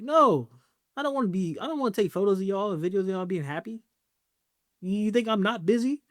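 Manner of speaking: fired-up refusal of the whole idea; exasperated protest. Acted. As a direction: push back hard, all worked up. Refusing Valentine's shoots, exasperated.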